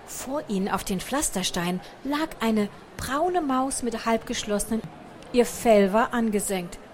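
ordinary delivery

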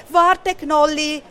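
slow, anxious storybook shout; worried call. A narrator's reading. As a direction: call after a friend with concern. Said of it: "ruft Berti besorgt".

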